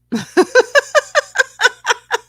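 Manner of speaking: 高く甲高い笑い声で、はじける笑い; high laugh. Real, not acted. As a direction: burst into a high-pitched laugh. Host reacts as guest drops off.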